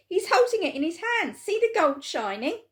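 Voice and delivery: high and loud; excited shout. A narrator's reading. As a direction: point it out eagerly. Onlooker shouting.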